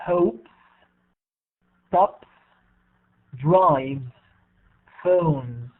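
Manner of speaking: word list read one at a time, endings sounded, from "Plays" to "puts"; teacher modelling each word from "Plays" to "puts", pausing after each; model pronunciation. Shown as a demonstration. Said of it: "as I pronounce them".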